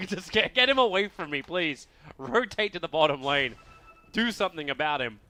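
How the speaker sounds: mock exasperated plea to teammates, loud at first then easing off; pleading player impression. Caster voices Afterlife pinging his teammates, mock pleading. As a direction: plead through a laugh as the fed-up player. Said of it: "he's just like".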